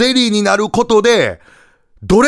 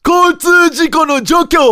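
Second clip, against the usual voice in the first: grand announcing; 12 semitones higher, much slower than usual. Retold or imitated. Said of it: "町のゆとり化計画".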